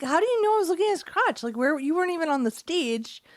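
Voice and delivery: quick, high-pitched mock protest; indignant retort. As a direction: argue back in disbelief, acting out the fight. Retells her side of the fight.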